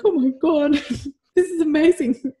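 high, loud and laughing with delight; thrilled, laughing gasp of joy; overjoyed. Real, not acted. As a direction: gush with happy disbelief. Reading son's proud text.

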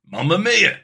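loud, hammed-up cartoon Italian accent; Mario Italian accent. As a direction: ham up a cartoon Italian plumber. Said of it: "my name's Mario".

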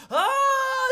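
high, loud put-on scream; mock scream. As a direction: scream out hysterically, hamming it up.

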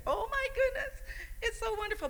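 very high, half laughing, half crying; tearful joy. Retold or imitated. Grandmother moved to tears by the baby's hand, tearful high joy. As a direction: choke up with happy tears. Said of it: "I cried".

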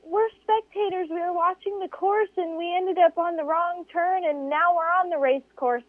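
high, bright comic voice of lost spectators. Retold or imitated. Lost spectators telling the stranded racer, high and clueless. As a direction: pitch way up and sound clueless.

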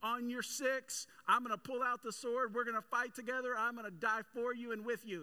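voicing a brash, eager Peter, high and loud; a cocky disciple swearing loyalty at full volume; eager boast. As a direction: Peter loudly pledging to die for Jesus, in a high, brash voice. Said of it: "And Peter's like".

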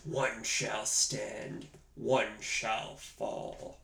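slow, soft, grave robot voice; ominous robot voice. Retold or imitated. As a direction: intone it slowly like a doomed giant robot. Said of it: "When we quote this movie".

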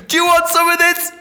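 high, loud challenge; acted threat. Gunman turning on the other dude, acted high yell.

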